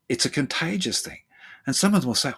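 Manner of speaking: ordinary delivery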